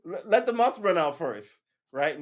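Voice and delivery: mock outrage at an early holiday; indignant outburst. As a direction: protest that the month isn't over yet. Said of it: "I was like".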